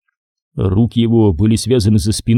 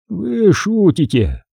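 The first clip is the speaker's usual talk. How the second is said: ironic reply; 9 semitones higher, much slower than usual. A narrator's reading. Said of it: "с иронией ответил майор".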